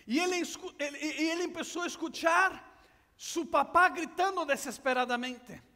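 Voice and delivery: ordinary talk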